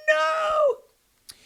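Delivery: very high wailing no; hammed-up despairing cry; squealing mock no; mock anguished no. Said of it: "They're like".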